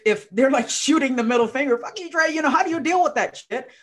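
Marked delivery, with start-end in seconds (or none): 1.8-3.7 high, loud, fast mock snap; mock angry retort. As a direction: spit it back like a bitter relative. Angry family member quoted.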